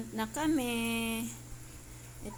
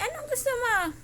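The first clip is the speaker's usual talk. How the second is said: high, loud questioning of a dog; exclaiming to a pet; 10 semitones higher, 5 dB louder, faster than usual. Real, not acted. Owner asking the complaining dog what it wants, high exclaiming. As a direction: ask the noisy pet in a raised, loud voice.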